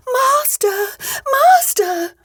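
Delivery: very high, piping harp voice; harp calling out. A narrator's reading. Magic harp calling its sleeping master, very high cry. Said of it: "called out quite loud".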